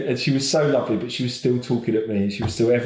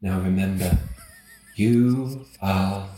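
lightly imitated calm meditation read; 4 semitones lower, slower than usual. Dad mimicking his own meditation recording. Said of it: "Twenty minutes of me going".

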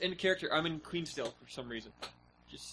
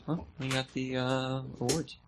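ordinary delivery

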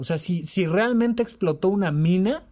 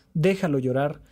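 usual speaking voice

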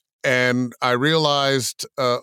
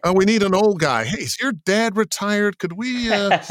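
mock boss voice; 8 semitones higher than usual. Imitating a boss casting voices.

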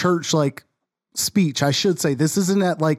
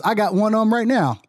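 mock proud caller; 7 semitones higher than usual. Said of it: "Somebody chimes in and says".

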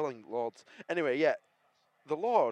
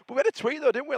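excited; 14 semitones higher, 7 dB louder than usual. Real, not acted. Excited reaction to Bradford news.

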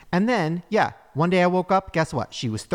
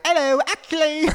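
high, louder mock-accent voice; hammed-up fake YouTuber confession; put-on accented reveal; 11 semitones higher, 4 dB louder than usual. YouTuber confessing to fans, high put-on accent. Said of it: "I wake up one day, and I'm just like".

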